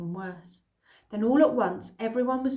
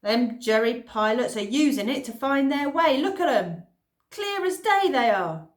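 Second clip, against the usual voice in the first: put-on old man voice; 4 semitones higher, 7 dB louder than usual. Old Mister Watkins voiced.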